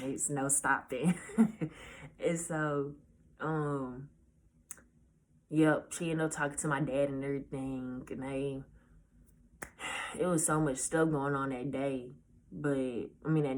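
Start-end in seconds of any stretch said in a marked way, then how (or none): none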